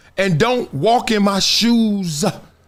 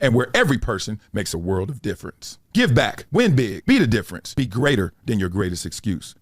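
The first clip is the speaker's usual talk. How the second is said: lower, steady promo read; 8 semitones lower than usual. Acted. Host reads an ad for Project Transition, steady promo voice. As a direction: sell the charity like a sponsor spot.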